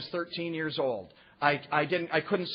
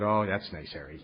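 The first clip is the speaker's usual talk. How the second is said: 1 sarcastic; 8 semitones lower than usual. Retold or imitated. Sarcastic reply to Harry.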